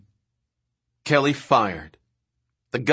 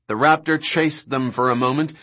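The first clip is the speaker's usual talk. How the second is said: ordinary delivery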